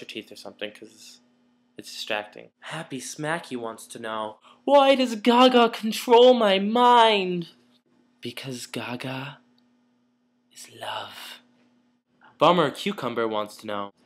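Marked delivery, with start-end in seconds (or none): 4.6-7.5 loud. Acted. Reading a fan question. 8.2-11.4 whisper. Acted. Devoted answer about [name].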